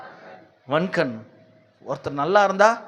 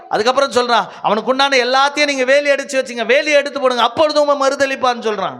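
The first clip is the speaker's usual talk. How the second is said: rapid-building, high impassioned sermon; full-force preaching; 8 semitones higher, 3 dB louder than usual. Real, not acted. Preacher voicing the accusation against Job, impassioned and driving. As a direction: drive each challenge home, pitch raised.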